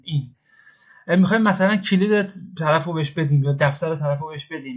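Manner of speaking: ordinary delivery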